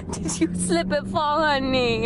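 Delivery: cooing concern. Real, not acted. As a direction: fuss over the dog gently. Cooing to dog.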